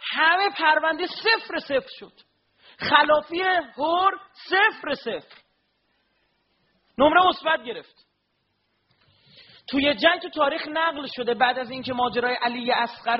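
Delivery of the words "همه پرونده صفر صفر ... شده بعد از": rising preaching. Acted. Preacher proclaiming to mourners, building to a cry. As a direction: drive it home to the mourners.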